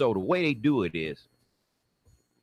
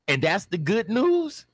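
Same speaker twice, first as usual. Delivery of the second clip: slow, high, loud incredulous question; loud disbelief; 12 semitones higher, 5 dB louder than usual. Acted. Man questioning the officer's so-called good news, loud disbelief.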